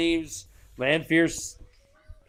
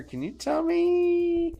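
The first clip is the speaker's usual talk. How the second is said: high, slow wheedling; pleading ask; slower than usual. Acted. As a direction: coax her, pitched up. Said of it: "He's like".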